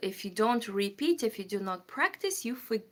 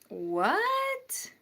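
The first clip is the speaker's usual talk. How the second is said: high, drawn-out incredulous question; disbelieving; 11 semitones higher than usual. Real, not acted. Streamer hearing the viewer's time, astonished. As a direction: stretch it upward in disbelief.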